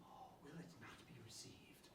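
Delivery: very soft, dawning whisper; conspiratorial whisper. Acted. Husband seeing how guilt can be shifted, conspiratorial whisper. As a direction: whisper it as the plot takes hold. Husband warms to secret plot.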